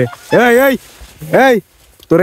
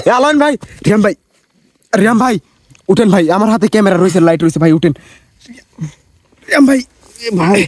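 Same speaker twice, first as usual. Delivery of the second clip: panicking gamer; 4 semitones higher than usual. Real, not acted. Frantic calling for missing friend.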